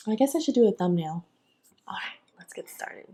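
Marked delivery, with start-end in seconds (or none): 1.9-3.0 breathy hushed whisper. Real, not acted. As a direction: whisper it under your breath. Whispering to the camera.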